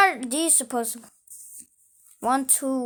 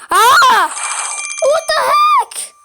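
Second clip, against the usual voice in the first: very high, loud startled shout; shocked cry; 10 dB louder, slower than usual. Real, not acted. Player shocked by a flood of food, high startled shout. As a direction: shriek in shock.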